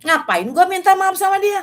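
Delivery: high, loud mock-indignant protest; indignant inner retort. Her own thought on being told to apologise to husband, indignant and high. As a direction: balk loudly at the very idea. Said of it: "Di pikiran saya gini".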